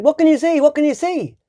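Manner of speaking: high squeaky little robber; short robber voice. A narrator's reading. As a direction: pitch it way up like a small eager robber. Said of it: "the shorter one said".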